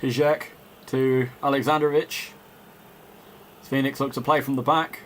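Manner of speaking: live play-by-play, caught up in the match; measured broadcast commentator voice; football commentary. Acted. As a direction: call the game like a TV commentator.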